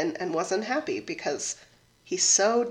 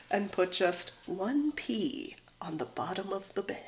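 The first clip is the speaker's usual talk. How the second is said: whisper; 8 dB quieter than usual. A narrator's reading. Secret pea placed.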